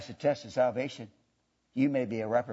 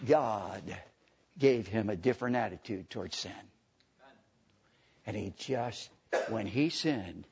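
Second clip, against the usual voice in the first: low, soft, halting pulpit delivery; hushed solemn preaching; 5 semitones lower, 5 dB quieter than usual. Real, not acted. Preacher telling the congregation sin broke his heart, low and hushed. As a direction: drop low with long pauses, sinking to a whisper.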